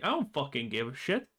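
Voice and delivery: normal talking voice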